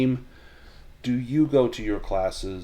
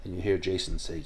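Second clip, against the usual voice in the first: ordinary talk, nothing marked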